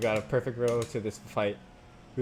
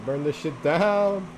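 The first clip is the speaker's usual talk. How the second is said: slow, loud, raised holler; celebratory shout; 5 semitones higher, 5 dB louder, much slower than usual. Real, not acted. Racer celebrating with a burnout, slow loud holler. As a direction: draw it out in a loud cheer.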